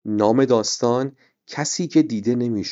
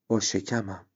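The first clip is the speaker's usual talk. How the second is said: much softer, almost to himself; quietly; 7 dB quieter than usual. A narrator's reading. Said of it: "امیرعلی آروم گفت". (آرام و خیلی آهسته)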